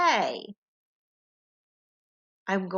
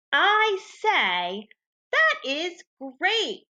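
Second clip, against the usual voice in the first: higher, slow, cheerful model read; model sentence read aloud; 8 semitones higher, 3 dB louder, much slower than usual. Shown as a demonstration. Teacher models the sentence for students to echo, excited and slow. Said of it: "Go ahead and be my echo".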